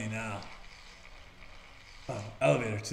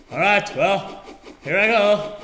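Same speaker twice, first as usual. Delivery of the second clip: excited launch; 4 semitones higher, 6 dB louder than usual. Real, not acted. Launching the character.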